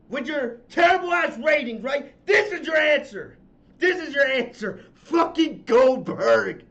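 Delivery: loud, bitter jabs at the company; angry rant. Real, not acted. Host ranting at WWE over bad ratings, angry. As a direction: spit out the contempt. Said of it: "I know I'm angry".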